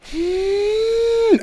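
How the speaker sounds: very high, held "hmm"; sustained squeal through closed lips; long high hum. Acted. Wordless end to the mocking rant.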